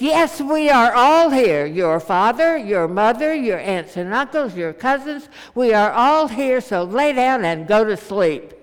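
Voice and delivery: loud, exasperated yell; angry outburst. Retold or imitated. Man in the sleeper car yells at the girl, exasperated. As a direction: bellow it to shut the kid up. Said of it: "after this outburst".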